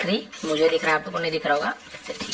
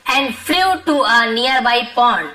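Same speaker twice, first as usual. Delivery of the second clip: slow dictation; much slower than usual. Shown as a demonstration. Teacher reading the lesson sentence to students, slow and deliberate. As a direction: read it out slowly so the class can follow.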